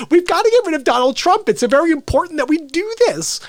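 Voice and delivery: high, loud, insistent mock politician; Klobuchar. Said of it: "And that's how she talked to me".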